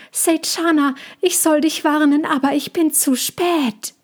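high small voice, apologetic warning; Mina's high voice. A narrator's reading. Mina warns Seychana from the tree, high and regretful. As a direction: call down a late warning in a high, odd voice.

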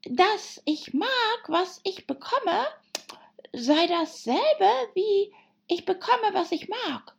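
very high, slow animal voice; March Hare, chiming in. Acted. March Hare adding his example for Alice, high and insistent.

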